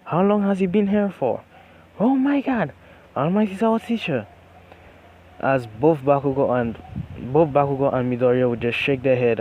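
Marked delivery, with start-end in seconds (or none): 0.0-4.3 loud, high-pitched amazed outburst; freaking out. A narrator's reading. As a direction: voice a whole class losing it over a celebrity. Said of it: "everyone would be freaking out".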